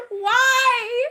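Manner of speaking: high, laughing wail; laughing dismay. Real, not acted. Streamer regrets telling Zenny, laughing in dismay. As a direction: wail it through real laughter.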